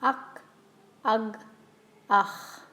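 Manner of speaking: "Ak", "ag", "ach" moving back, "ach" breathy and throaty; throat sounds with a vowel. Shown as a demonstration. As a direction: slide from k to g to a rasped ach. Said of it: "Try it with the sound before it".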